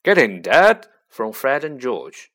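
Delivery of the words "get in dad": loud cheering shout; yell. A narrator's reading. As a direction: cheer Dad on, yelling. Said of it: "There was a yell of".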